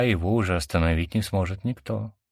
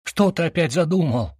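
shouting; 9 semitones higher than usual. A narrator's reading. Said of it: "закричал он".

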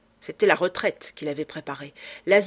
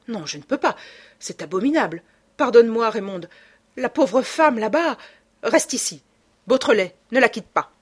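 distraught urgency; 4 semitones higher, 6 dB louder than usual. A narrator's reading. Lupin torn, rushing to help.

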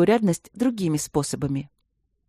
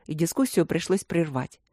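ordinary delivery, nothing marked

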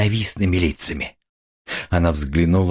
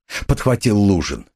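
ordinary delivery

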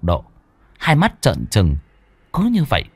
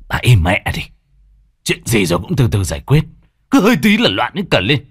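irritated husband; 3 semitones higher, 3 dB louder, faster than usual. Acted. Husband snaps at wife.

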